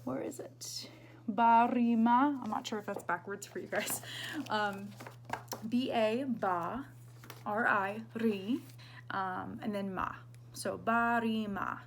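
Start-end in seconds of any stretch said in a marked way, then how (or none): 0.0-0.9 whisper. Real, not acted. Searching the page, aside. 1.3-2.4 barima slow and loud, syllable by syllable. Shown as a demonstration. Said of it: "it's separated by syllables". 5.7-10.1 B A, ba, R I, ri, ma spelled out; syllable by syllable. Shown as a demonstration. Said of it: "it's separated by syllables". 10.5-11.8 barima with a tapped R, slow; flipped R. Shown as a demonstration. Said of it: "Make sure you're flipping that R".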